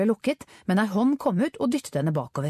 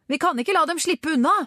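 high-pitched, urgent insistence; determined shout; 10 semitones higher, 3 dB louder than usual. A narrator's reading. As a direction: urge him on over the chase. Shouted answer during scooter chase.

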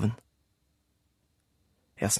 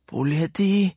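Rulle alarmed; 8 semitones higher, 4 dB louder, slower than usual. A narrator's reading. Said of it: "sa Rulle".

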